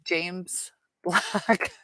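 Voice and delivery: laughing under her breath at the name; a breathy giggle through the name; amused whisper. Real, not acted.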